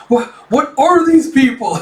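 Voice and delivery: high, loud panicked soldier; terrified soldier. Acted. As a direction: gasp it out in terror. Soldier seeing monsters.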